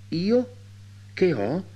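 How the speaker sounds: high-pitched, bewildered question; a startled servant girl caught off guard; stunned maid. A narrator's reading. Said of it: "fece la servetta stordita dalla domanda". (servetta stordita dalla domanda)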